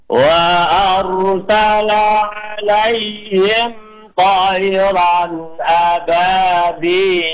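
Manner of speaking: slow melodic tartil chant; teacher modelling tajwid recitation; Quran recitation. Shown as a demonstration. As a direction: recite it measured and sung. Recites verse.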